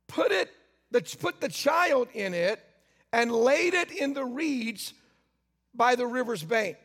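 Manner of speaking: slow, solemn reading of scripture. Acted. Preacher slowly reads the Bible to the congregation. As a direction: read the verses slowly, weighing each line.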